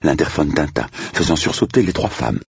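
ordinary delivery

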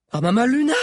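high, loud rising exclamation; alarmed cry. A narrator's reading. Sabi seeing the pursuers charge, alarmed cry. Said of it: "cria Sabi".

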